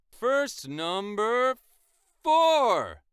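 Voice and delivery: big, high, drawn-out announcer shout; mock-dramatic loud countdown call; hammed-up announcement. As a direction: call out the heading, pausing before a booming finish.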